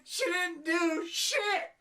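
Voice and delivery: high, slow hammed-up whimper; mock sobbing. Reviewer mimicking Laurie's sister weeping, mock crying. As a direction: fake a helpless cry.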